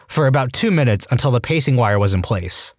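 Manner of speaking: plain reading voice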